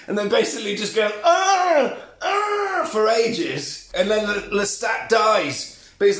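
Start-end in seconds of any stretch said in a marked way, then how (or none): 1.2-2.9 high, drawn-out imitated cries; hammed-up high "ah" moans; mock dying wail. Lestat being bitten for ages, mock high wails.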